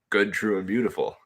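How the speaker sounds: ordinary talk, nothing marked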